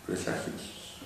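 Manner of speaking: whispered syllable-by-syllable presagios. Shown as a demonstration. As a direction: whisper presagios, with the I barely there. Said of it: "La I como que pierde fuerza en presagios".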